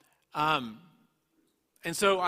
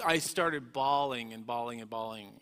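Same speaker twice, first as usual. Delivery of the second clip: ordinary talk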